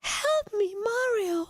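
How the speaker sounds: very high, squeaky damsel cry; a helpless princess calling out; Princess Peach voice. Pretend. Princess Peach cries out to Mario, very high. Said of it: "hard to do Wario and Princess Peach".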